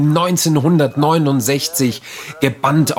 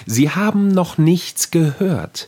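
story narration; 5 semitones higher than usual. Acted. Literary reading.

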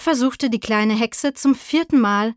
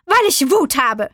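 loud, raised, furious little witch; angry outburst; 5 semitones higher, 5 dB louder than usual. A narrator's reading. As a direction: burst out with it angrily. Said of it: "rief sie zornig". (wütend herausgerufen)

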